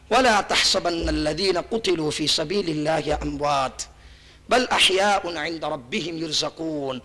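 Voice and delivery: preacher breaks into slow Arabic Quran chant; Quranic recitation. Acted. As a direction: intone the verse slowly like a reciter. Quoting a Quran verse.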